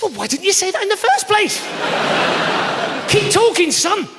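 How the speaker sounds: high, mock-eager sarcasm; sarcastic. Customer egging on the cold caller, heavily sarcastic. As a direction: feign sudden keen interest, laying it on thick. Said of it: "'cause he didn't get the sarcasm".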